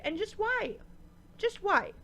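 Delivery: high, incredulous just why; exasperated. Real, not acted. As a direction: throw up your hands. Exasperated repeated question.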